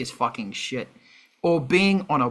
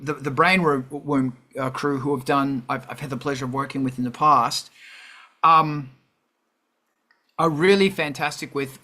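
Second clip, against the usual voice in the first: normal talking voice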